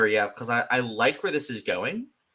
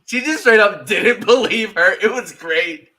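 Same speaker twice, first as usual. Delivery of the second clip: loud laughing, voice pitched up; 9 semitones higher, 9 dB louder than usual. Real, not acted. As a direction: laugh through the words. Laughing while retelling scene.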